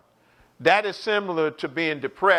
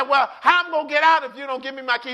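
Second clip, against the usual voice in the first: fast, high-pitched protest; retold protesting wife; 7 semitones higher, 3 dB louder, faster than usual. Wife asking husband for her keys, imitated exasperated protest. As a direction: rattle it off in exasperation.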